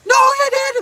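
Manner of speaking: very high mock shriek; mock screaming. Woman blurting over his story, mock screaming. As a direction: blurt it out in a high mocking holler. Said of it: "she start blurting out, like, screaming and hollering".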